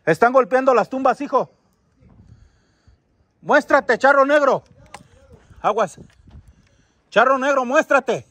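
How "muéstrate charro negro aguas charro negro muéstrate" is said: calling out. Real, not acted. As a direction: summon a spirit in a raised, commanding voice. Calling out a spirit. (invocación en voz alta, desafiante)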